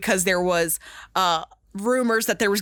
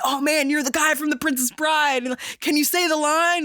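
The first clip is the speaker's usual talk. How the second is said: higher-pitched starstruck fan voice; imitating an excited admirer, pitched up; eager fan; 6 semitones higher than usual. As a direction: gush like a fan meeting the actor. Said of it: "people coming up to me and saying".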